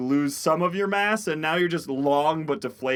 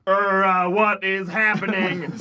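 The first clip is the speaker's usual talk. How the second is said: Kennedy impression; 4 semitones higher, 4 dB louder than usual. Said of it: "the voice of Mountain Aim going".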